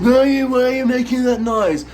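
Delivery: high, loud mock exasperated question; retold boyfriend's question. Boyfriend quoted while she kicked her foot, loud high mock question. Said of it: "And he said".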